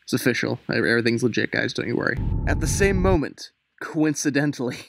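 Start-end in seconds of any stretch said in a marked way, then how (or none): none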